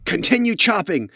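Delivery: loud, high-pitched urging; commanding woman leader. Acted. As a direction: overrule the threat and drive the men on. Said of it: "hear her start giving commands".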